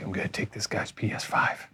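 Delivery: unvoiced sneaky burglar whisper. Burglar sneaking in, whispering to himself. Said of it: "being like".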